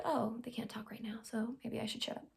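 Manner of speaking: whispered inner thought of the texter; mock whispered aside. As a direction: hush it like a private realisation.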